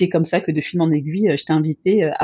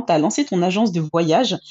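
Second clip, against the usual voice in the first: ordinary talk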